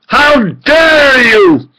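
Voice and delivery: high, slow, crying out how dare you; drawn-out outraged cry. Real, not acted. Man raising his voice at his enemies, crying out slowly. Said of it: "And I raise my voice".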